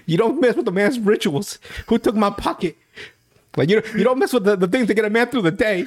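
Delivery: high, fast, frantic pleading; Thad melting down. Retold or imitated. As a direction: play the panicked superstitious jock. Acting out Thad's meltdown.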